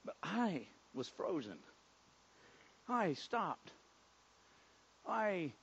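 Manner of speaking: very soft, halting, awestruck; quiet wonder, stopped in your tracks; hushed amazement. Acted. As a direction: barely voice it, with long stunned pauses. Healed leper frozen in amazement.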